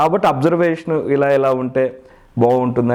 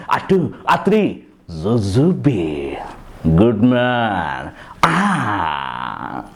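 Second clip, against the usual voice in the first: Baba film hero; 3 semitones higher than usual. Acted. Mimic doing Baba's warning and countdown, swaggering hero voice. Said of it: "ఇది ఒక రకమైన బాబాలో".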